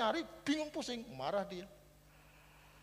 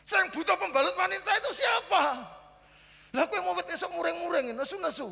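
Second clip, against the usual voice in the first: higher, louder, scolding wife's outburst; mock angry wife; 12 semitones higher, 9 dB louder than usual. Wife scolding her husband over the missing brush, mock angry. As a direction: rant like the annoyed wife.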